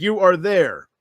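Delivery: usual speaking voice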